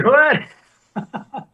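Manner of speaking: very high, stretched-out what; stunned what. Retold or imitated. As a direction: let the disbelief shoot up. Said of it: "I was just like".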